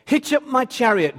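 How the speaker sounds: king calling for his chariot, loud and high; commanding shout. Acted.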